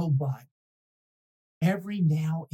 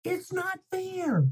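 whiny complaint; 12 semitones higher than usual. Acted. Pigeon complains about unfairness.

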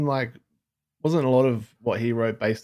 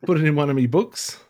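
hammy Aussie Plato voice; 5 semitones higher, 4 dB louder than usual. Retold or imitated. Continues voicing Plato as storyteller.

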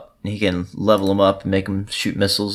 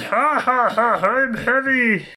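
heavy gunner voice, slow and booming; big deep voice; 14 semitones higher, much slower than usual. Retold or imitated. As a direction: play a huge brute laughing slowly. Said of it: "big, deep voice, and he goes".

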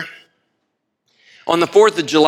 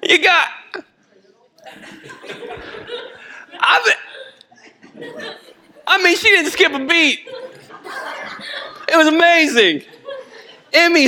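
laughing through tears; 14 semitones higher than usual. Real, not acted. Laughing-crying at the memory.

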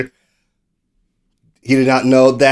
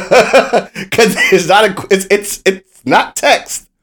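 laughing; 5 semitones higher, slower than usual. Real, not acted. Laughing while explaining the graphic.

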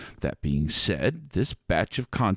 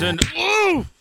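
high grunt of impact; pained oof; 4 dB louder than usual. Acted. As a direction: get punched mid-line. Santa gets hit.

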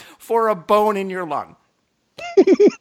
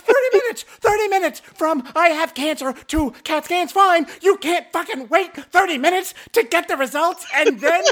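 high-pitched outraged screaming rant; 12 semitones higher than usual. Retold or imitated. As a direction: shriek the complaint in sheer disbelief. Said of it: "And I'm screaming".

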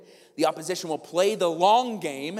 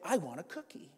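much softer, hushed aside; quiet translation; 2 semitones lower, 11 dB quieter than usual. Acted. Parent decoding what the kid really means, quiet. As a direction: slip it in under your breath. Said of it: "what they're saying is".

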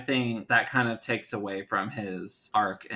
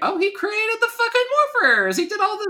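very high, squealed hype; over-the-top, high-pitched excitement; mock amazement; 5 dB louder than usual. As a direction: gush in an exaggerated squeal. Mimicking overblown praise of the scientist.

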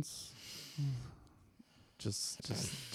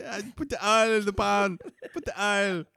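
put-on a light Jamaican accent, higher pitch; 7 semitones higher than usual. Mimicking the restaurant guy's cooking talk, light Jamaican accent. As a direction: lightly imitate the Jamaican cook. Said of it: "the Jamaican guy from the restaurant".

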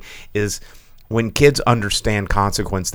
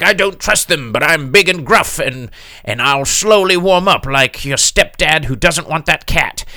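gruff guy voice; 7 semitones higher, 5 dB louder than usual. Retold or imitated.

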